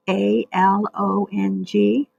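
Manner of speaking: A L O N G spelled slowly, one letter at a time. Shown as a demonstration. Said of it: "See that word along?".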